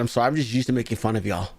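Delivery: usual speaking voice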